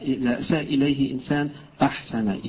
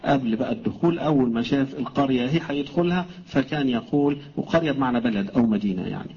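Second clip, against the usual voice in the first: ordinary talk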